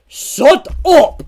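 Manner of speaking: loud, high shout with accidental Russian tinge; Russian-sounding. Acted. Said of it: "that sounded Russian".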